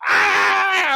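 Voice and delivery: high loud strangled cry; mock choking scream. Grabber choked with a phone cord, a high strangled mock scream.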